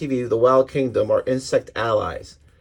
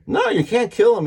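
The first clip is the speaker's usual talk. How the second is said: high-pitched acted objection; alarmed protest; 9 semitones higher, 3 dB louder than usual. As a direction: plead in protest, pitch jumping up. Acted protest to save the child.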